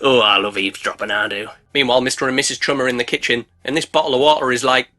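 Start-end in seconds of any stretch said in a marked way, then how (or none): 0.0-1.5 much lower, sly purr; low creepy shark voice. Acted.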